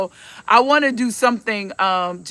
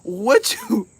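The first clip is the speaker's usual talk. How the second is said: ordinary delivery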